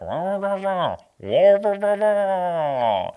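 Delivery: muffled wah-wah announcer noise. Retold or imitated. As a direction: mimic a distant muffled announcer. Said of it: "hear announcers like".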